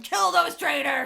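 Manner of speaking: loud, slow battle cry; patriotic shout. Retold or imitated. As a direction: yell it like a war call. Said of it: "this is the American in me going".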